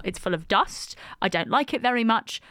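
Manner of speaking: ordinary delivery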